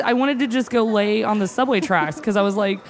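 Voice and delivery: ordinary talk, nothing marked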